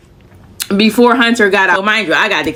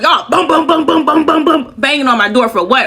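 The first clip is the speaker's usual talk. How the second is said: imitated banging; 5 semitones higher than usual. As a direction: boom boom knocking sounds. Imitating door banging, indignant.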